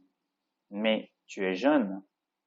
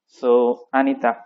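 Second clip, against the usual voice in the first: ordinary talk, nothing marked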